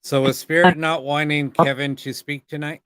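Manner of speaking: loud questions to an empty room; calling out to spirits. Real, not acted. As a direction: project the voice into the dark house.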